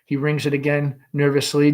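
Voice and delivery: ordinary delivery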